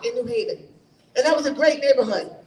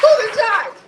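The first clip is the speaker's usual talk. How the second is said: raised, louder challenging question; loud demand; 6 semitones higher, 5 dB louder than usual. Retold or imitated. Candidate repeats her challenge to public works for the crowd, loud demand. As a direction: call it out high and loud to the crowd.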